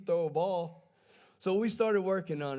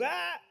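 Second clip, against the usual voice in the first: high yelp like ducking a ball; a sudden high mock cry of fright; imitated high flinch yelp; startled yelp; 12 semitones higher than usual. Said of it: "he goes".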